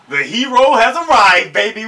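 loud, high, triumphant announcement; hyped cheer. Real, not acted. Fan cheering as the hero shows up, excited. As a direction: hail the hero loudly.

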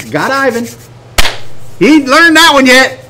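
high-pitched gloating enemy. Acted. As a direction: crow as the scheming enemy. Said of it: "the enemy's going".

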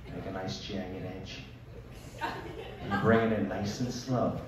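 a dad stalking the last poop, softly; hushed suspense. Pretend. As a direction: creep in like a hunter. Dramatic diaper-wipe scene.

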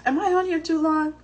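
ordinary talk

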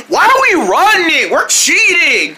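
high, loud alarm; panicked shout. Acted. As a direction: yell while running. Panicked running.

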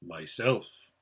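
deep, quiet rumble of a great voice; low, solemn, weighty utterance. A narrator's reading. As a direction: drop the voice right down. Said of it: "very deep and low".

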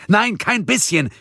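viel höher und lauter gerufen; fast brüllend. A narrator's reading. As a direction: ins Telefon brüllen. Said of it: "brüllte ihr jetzt fast ins Ohr". (Gabriel brüllt Mia fast ins Ohr)

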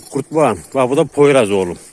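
ordinary talk

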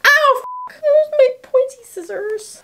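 high yelp of pain, then high whine; sudden pain. Real, not acted. Pricked by pointy scissors, pained yelp.

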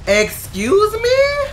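very high, slow, loud squeal of outrage; shrieked disbelief. Real, not acted. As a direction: stretch the words in a shocked shriek. Startled by enemy.

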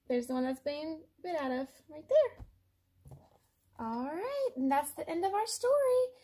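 usual speaking voice

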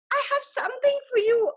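high, quick teasing offer; excited surprise. A narrator's reading. Tree teases the boy with a surprise, high and quick. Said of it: "the tree said".

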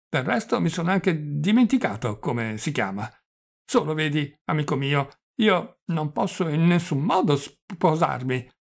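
stammering, doddering confession; flustered old prince. Acted. Old prince confessing a proposal to his nephew, flustered and stammering. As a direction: draw words out, stumble on consonants.

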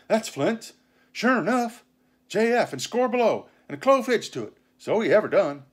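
high, loud pirate voice; gleeful crewman crowing over a find; excited mutineer. Acted. Pirate recognizing Flint's signature on the chart, excited.

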